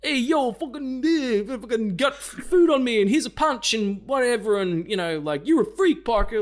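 high, loud, hammed-up jock bully; a loud, high caricature of a jock sneering at Parker; Joe Manganiello bully. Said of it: "Joe Manganiello being like".

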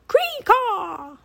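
high, loud bird screech; raven croak. A narrator's reading. Raven King orders his bodyguard, croaking screech. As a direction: screech a command like a crow. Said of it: "he croaked to one of his bodyguards".

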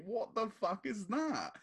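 high, slow cry of disbelief; amazed. Real, not acted. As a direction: marvel at the goal, drawing it out. Amazed at bicycle kick goal.

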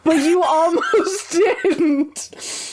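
slow, laughing through the words. Real, not acted. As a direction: chuckle while teasing. Laughing at the lucky roll.

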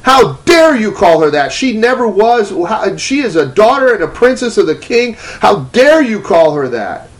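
indignant high scolding of God retold; screaming rebuke. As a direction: thunder the rebuke, drawing out key words. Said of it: "is screaming at me and says".

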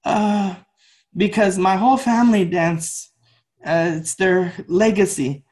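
high, hesitant student voice; nervous answer. Retold or imitated. Second dancer answers yelling teacher, mock-nervous reply. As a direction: imitate a cowed dancer offering a careful answer.

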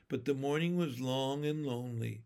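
usual speaking voice

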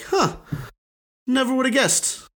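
high-pitched, understated huh of discovery; mild surprise. Retold or imitated. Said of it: "it did shock me".

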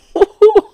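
loud high burst of laughter; real high-pitched delighted laugh. Real, not acted. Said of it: "I'm laughing 'cause it's just so good".

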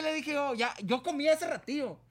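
más agudo y fuerte, nervioso; nervioso asustado. Retold or imitated. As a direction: contestar asustado y apurado. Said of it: "yo estaba bien asustado". (muchacho asustado rechaza a la patrona, agudo)

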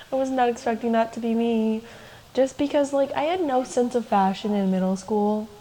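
ordinary talk

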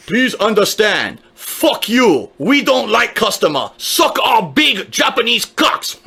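hammed-up Japanese accent, dragged out; mocking a company boss in a slow put-on Japanese accent; mock Iwata. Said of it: "Iwata could come out and say".

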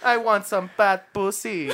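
slow, loud, angry Filipino grandpa; Filipino grandpa accent. Pretend. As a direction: growl it like an old Filipino man. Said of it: "hella thick accent, but, like, angry".

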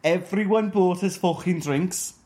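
exaggerated Liverpool Scouse accent. Shown as a demonstration. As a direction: tell it in throaty Liverpudlian Scouse. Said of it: "talking in a Scouse accent".

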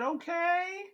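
very high, soft, stretched-out reply; puzzled squeaky acknowledgement; baffled okay. Retold or imitated. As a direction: draw it out high, unsure. His baffled reply to the Reaper.

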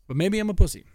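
loud self-mockery. Real, not acted. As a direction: blurt it out at yourself. Self-mocking outburst.

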